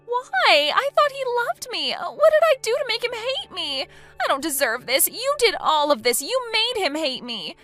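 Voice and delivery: high, loud anguished cries; anguished pleading. Acted. Jilted bride blaming sister-in-law, anguished high cries. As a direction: plead and blame through heartbreak.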